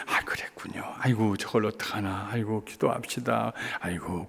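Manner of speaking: hushed, gentle sympathy; soft consoling murmur. Retold or imitated. Pastor softly soothing the troubled wife, hushed. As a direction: murmur comfort under the breath. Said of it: "그럴 때".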